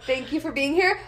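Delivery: usual speaking voice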